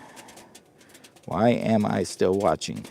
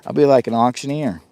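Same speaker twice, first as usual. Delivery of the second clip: ordinary delivery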